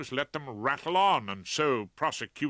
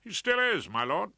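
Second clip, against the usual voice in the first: much higher, emphatic reply; firm detective; 9 semitones higher, 4 dB louder than usual. Acted. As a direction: insist to the judge. Chief Inspector firm.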